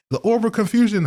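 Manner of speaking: high-pitched cartoon announcement; SpongeBob episode imitation. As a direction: pitch it way up like a quoted cartoon line. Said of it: "episode of SpongeBob where it's like".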